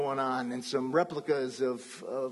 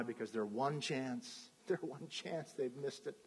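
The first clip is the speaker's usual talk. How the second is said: much softer, let-down murmur; disappointed; 3 semitones lower, 11 dB quieter than usual. Acted. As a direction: sink with the loss. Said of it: "they're disappointed".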